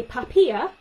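Papier said the German way, PAH-peer; German word. Shown as a demonstration. Speech therapist to parents, demoing a German word. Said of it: "So if I say, um, in German".

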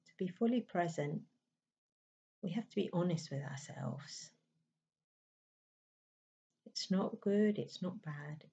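calm, unhurried meditation voice with long pauses; even, settled delivery in short, spaced phrases; guided meditation. Acted. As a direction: gently guide them inward, leaving space between phrases.

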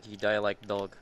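put-on broken English in a Russian accent; deadpan Russian villain line. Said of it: "weird Russian accent from time to time".

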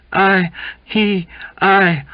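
high, loud broken starts; agitated, halting outburst; stammering. A narrator's reading. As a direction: grope for words in agitation. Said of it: "he stammered".

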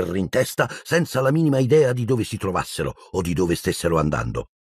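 usual speaking voice

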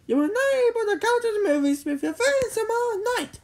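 high-pitched scolding mother; stern mom. A narrator's reading. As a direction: hand down a punishment in a high voice. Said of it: "Jimmy's mom said sternly".

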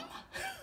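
quiet high-pitched giggle; high soft laugh. Real, not acted. Host amused by her borrowed catchphrase, soft laugh. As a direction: giggle softly at your own joke.

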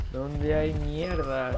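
ordinary talk

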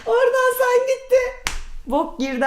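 words pushed out through a high laugh; laughing while speaking. Real, not acted. Telling the poo shoe story to his sibling, laughing. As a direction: talk through the giggles, slowly.

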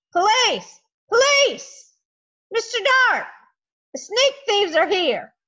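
high, loud alarm cry calling for help; loudest terrifying shout. Acted. Stanley calling out to catch the thieves, loud terrifying shout. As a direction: yell for the police at the top of his lungs. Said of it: "shouted out in his loudest, most terrifying voice".

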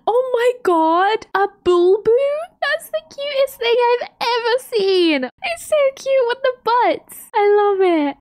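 high, squealy gush over something adorable; high-pitched cooing squeal; cute overload squeal. Real, not acted. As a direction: melt over a cute creature, voice way up.

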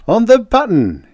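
very high, loud crow of delight; gleeful. A narrator's reading. Joker finding the time exactly right, gleeful. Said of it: "he said gleefully".

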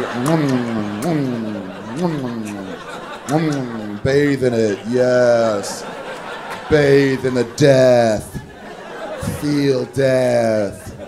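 low, slow, hammed-up savoring moans; hammy sultry moaning over the meat; mock sensual. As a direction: revel in it like a decadent glutton. Mock sensual eating scene.

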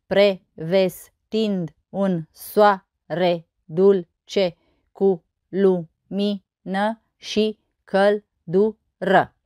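very slow, each syllable separated. Shown as a demonstration. Teacher counting verse syllables on fingers, syllable by syllable. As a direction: count out the syllables one at a time. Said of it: "Și să nu uităm de degete".

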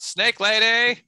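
high, loud call into the distance; shouted summons. Acted. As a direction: call out loudly to summon someone. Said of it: "I just shout".

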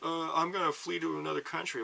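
high, mocking whine of a sore loser; whiny voter. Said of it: "if you're like".

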